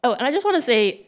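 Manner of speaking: ordinary talk, nothing marked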